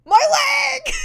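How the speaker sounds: high, loud anguished yell of My leg; a mock wail, pitched well up and loud; pained cry. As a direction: cry out in sudden pain over the leg. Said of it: "Ashishi's just like".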